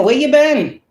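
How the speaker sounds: high, exaggerated nosy asking, half-hearted; mock sudden interest. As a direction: play the flaky friend pretending to care. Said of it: "mocking returning people".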